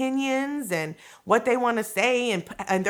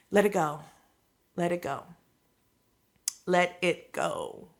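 gentle calm release, each softer; soothing, fading softer; 4 semitones lower, 6 dB quieter than usual. Real, not acted. Urging release of tension.

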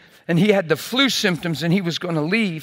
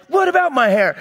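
mad; 7 semitones higher, 4 dB louder than usual. Retold or imitated. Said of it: "He got mad".

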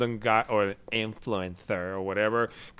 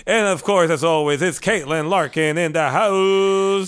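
grand entrance announcement; 6 semitones higher, 5 dB louder than usual. Acted. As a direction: announce her like a star arriving. Introduces co-host as announcer.

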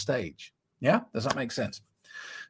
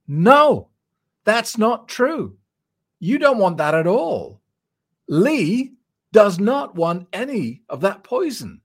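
high and louder, insistent; emphatic; 8 semitones higher, 5 dB louder, slower than usual. Real, not acted. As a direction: shut the idea down firmly. Coach firmly contradicting client.

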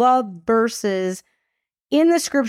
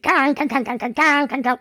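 higher mock guitar riff; imitated angry music; 4 semitones higher than usual. Teen blasts angry music after slammed door, mock riff. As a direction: kang kang like pounding rock music. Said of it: "I turned on the angry music".

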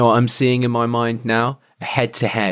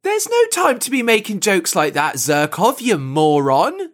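mock scolding; 7 semitones higher than usual. Acted scolding of the prankster.

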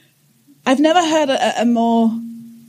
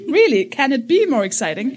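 laughing; 4 semitones higher than usual. Real, not acted.

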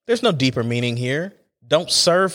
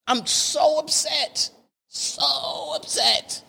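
high, slow, whiny wail of dismay; so upset; 11 semitones higher, 3 dB quieter, much slower than usual. Real, not acted. Podcaster wails over dry restaurant chicken fingers, high whiny moan. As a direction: moan it like a wounded chicken lover. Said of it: "how mad I was".

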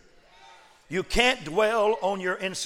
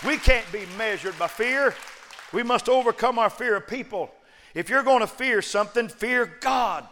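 preaching at full force; 6 semitones higher, 3 dB louder than usual. Acted. Preacher rallying the congregation with full force. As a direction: climb each line higher and louder to a shout.

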